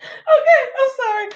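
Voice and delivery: high, tearful giggly apology; laughing tearfully. Real, not acted. Apologizing to listeners after a laughing fit, still tearful. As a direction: catch your breath still half laughing.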